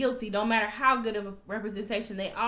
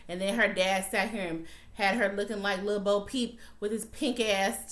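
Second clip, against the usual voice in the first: ordinary talk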